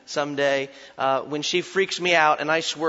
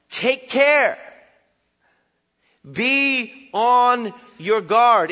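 loud, slow, ringing warning; big preacher's warning call; booming warning; 8 semitones higher, 10 dB louder, much slower than usual. Acted. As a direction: thunder it out like a prophet.

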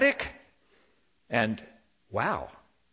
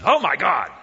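loud surprised "Oh my God!"; exclamation; 3 semitones higher, 7 dB louder than usual. Shown as a demonstration. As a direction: blurt "Oh my God!" as a startled cry. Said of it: "an exclamation".

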